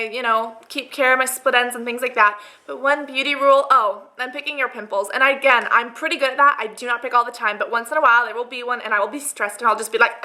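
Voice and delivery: ordinary talk, nothing marked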